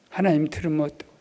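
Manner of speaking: usual speaking voice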